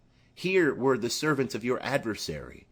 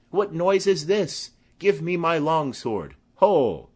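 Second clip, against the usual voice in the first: higher, louder demand for his sword; old man's outraged shout; 5 semitones higher, 5 dB louder than usual. Acted. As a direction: bluster and call for the sword. Old Capulet roused by the brawl.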